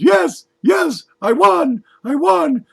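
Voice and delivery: very high, loud squeal of victory; squealing with joy. Acted. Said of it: "jumps up and down and squeals".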